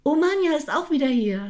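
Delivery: high, loud glad cry; delighted welcome. A narrator's reading. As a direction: welcome her back joyfully. Friends welcome her back.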